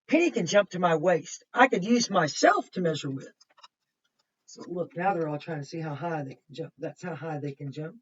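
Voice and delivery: ordinary talk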